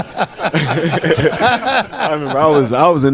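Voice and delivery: real laugh breaking into the words. Real, not acted. Player laughing as he begins his game memory, amused. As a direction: start talking through a laugh.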